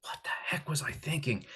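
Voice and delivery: acted-out baffled half-whisper; hushed self-questioning. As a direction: mimic puzzling to yourself under your breath. Acts out baffled morning self.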